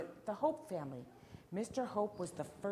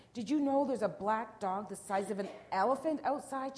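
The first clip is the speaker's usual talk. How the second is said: loud, raised storybook shout; big, higher-pitched cry of alarm; alarmed yell; 4 semitones higher, 9 dB louder than usual. A narrator's reading. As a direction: yell it in panic across the house. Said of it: "she yelled".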